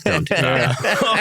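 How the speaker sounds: high, slow mock protest; pleading with a gadget, half laughing; laughing mock plea to a device; mock scolding the pin. Imitating reaction to pin face.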